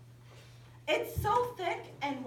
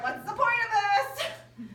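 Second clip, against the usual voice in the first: exasperated call; 10 semitones higher, 8 dB louder, slower than usual. Retold or imitated. Frustrated question to instructor.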